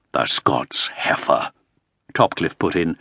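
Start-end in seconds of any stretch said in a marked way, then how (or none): 0.1-1.5 low, slow contemptuous jibe; sneering. A narrator's reading. Topcliffe mocking the Scots Queen before the Earl, slow sneer. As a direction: spit the insult out slowly.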